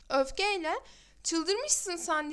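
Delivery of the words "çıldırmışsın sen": usual speaking voice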